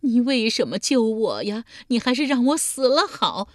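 loud, high sobbing protest; howling sobs. A narrator's reading. Revived old woman howls at her rescuer, loud and high. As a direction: howl it out, begging to be left to die. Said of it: "嚎啕大哭道".

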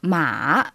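"ma" dipping low, then rising; third tone. Shown as a demonstration. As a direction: let "ma" dip down and come back up. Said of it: "le troisième ton".

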